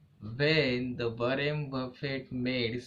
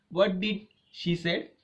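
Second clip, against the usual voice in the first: ordinary talk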